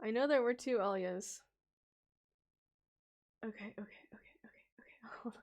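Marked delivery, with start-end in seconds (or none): 3.4-5.4 breathy whisper, much softer. Real, not acted. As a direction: whisper it to yourself to calm down. Calming herself, under her breath.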